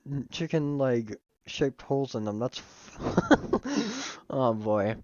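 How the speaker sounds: usual speaking voice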